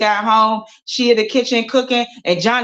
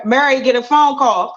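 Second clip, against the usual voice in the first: ordinary delivery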